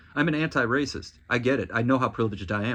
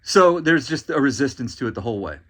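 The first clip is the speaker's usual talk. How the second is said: ordinary talk, nothing marked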